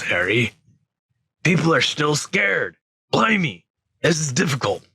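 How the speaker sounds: Hagrid voice. Acted. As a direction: fumble for words like a frightened giant. Frightened Hagrid in character.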